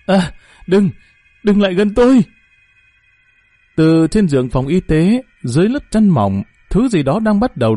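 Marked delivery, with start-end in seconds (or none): 0.0-2.2 high, panicked plea, not full volume; gasp, stammer, then cry out to keep it away; terrified cry. A narrator's reading. Lý warding off the grinning head, high frightened cry. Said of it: "hét lớn".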